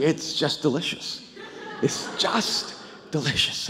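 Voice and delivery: soft, near-whispered, slow, relishing; savoring murmur. Retold or imitated. Owner savoring his own stadium to a visitor, soft hushed relish. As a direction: breathe the word out like tasting it. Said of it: "he kept saying".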